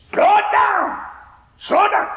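high, loud frantic holler. Retold or imitated. Old woman hollering at the flagman, high and loud. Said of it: "she hollered and screamed".

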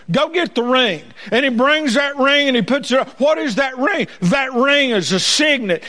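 high, fervent pulpit delivery; full-force preaching. Real, not acted. Preacher testifying to what the father sees, full-force preaching.

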